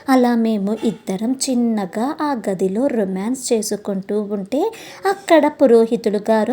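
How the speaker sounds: ordinary delivery, nothing marked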